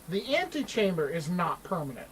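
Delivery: usual speaking voice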